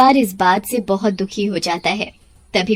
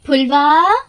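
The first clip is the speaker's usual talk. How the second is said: slow, higher, drawn-out call for her daughter; anxious mother calling, higher and slow; 8 semitones higher, 3 dB louder, slower than usual. Acted. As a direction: call across the house for the missing girl. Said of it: "उसे बहुत पुकारती है".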